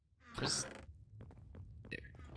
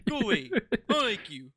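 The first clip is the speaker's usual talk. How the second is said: lightly hammed-up huff, pitched higher; mock rejection; 6 semitones higher, 3 dB louder than usual. Teammate shoos the other off in island spat, mock huffy. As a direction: playfully shoo them off in a raised pitch.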